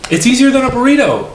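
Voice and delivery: high, drawn-out incredulous whine; exasperated protest. Retold or imitated. Customer arguing over a quesadilla, exasperated. Retelling outrage at the counter.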